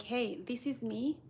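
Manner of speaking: usual speaking voice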